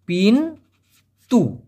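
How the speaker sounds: পিন then তু, split into two separate syllables with a pause; পিন and তু broken apart for learners. Shown as a demonstration. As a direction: spell out পিন তু one beat at a time. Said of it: "দরজাকে Malaysian ভাষায় বলা হয় পিন্তু".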